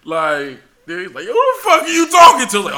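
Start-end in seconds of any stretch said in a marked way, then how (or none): 1.2-2.6 very loud, high outraged challenge; retold angry confrontation. Instructor storms into the bathroom at the recruit, imitated angry shout.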